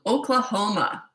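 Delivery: "Oklahoma" savoured, sung-out tune; enjoy every syllable of "Oklahoma"; relishing the word. Real, not acted. Host reading a viewer's location, relishing "Oklahoma". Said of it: "I just like saying that".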